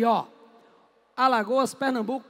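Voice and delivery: ordinary talk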